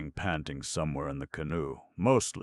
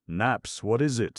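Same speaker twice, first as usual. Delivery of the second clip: snapped; 8 semitones higher, 8 dB louder than usual. Acted. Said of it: "snapped Guy".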